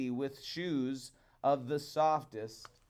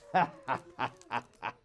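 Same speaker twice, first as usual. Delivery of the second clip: gloating laugh. A narrator's reading. Wife gloats as mistress of seas.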